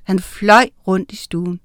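ordinary delivery, nothing marked

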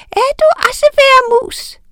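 put-on very high, loud toddler voice; very high baby tease; baby witch. A narrator's reading. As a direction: tease like a tiny giggling witch.

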